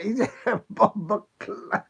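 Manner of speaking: high, loud, breaking up with laughter; laughing exclamation. Retold or imitated. Vlogger stopping short of a rude expression, laughing. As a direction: laugh through the half-said rude word.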